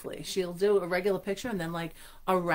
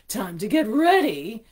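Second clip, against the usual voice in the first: cackling with delight; 4 semitones higher, 10 dB louder, slower than usual. Acted. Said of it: "she cackled with delight".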